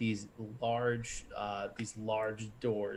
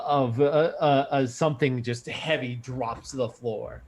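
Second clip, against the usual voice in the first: usual speaking voice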